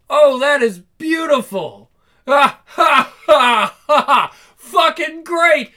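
loud belly laugh breaking through; cracking up, loud; loud laughter. Real, not acted. Teammate laughing loud at the insult.

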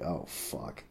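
quiet dread at her answer; groaning mutter. Retold or imitated. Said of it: "And I was like".

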